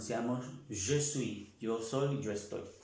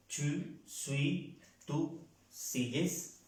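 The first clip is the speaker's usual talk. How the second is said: slow; 2 semitones higher, much slower than usual. Shown as a demonstration. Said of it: "teacher repeats conjugation slowly".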